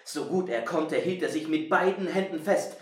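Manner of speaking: usual speaking voice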